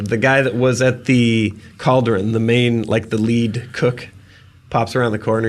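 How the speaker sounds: normal talking voice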